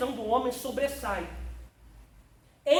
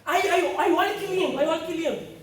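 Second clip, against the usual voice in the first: loud, higher laughing exclamations; excited shouting; 5 semitones higher, 11 dB louder than usual. Real, not acted. Preacher thrilled by the point, shouting and laughing. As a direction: erupt with joy at the revelation.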